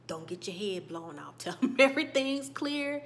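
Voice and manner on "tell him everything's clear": laughing, louder and higher; half-laughing, louder take; louder threat. Retold or imitated. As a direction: repeat it with a laugh and force. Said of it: "And she said".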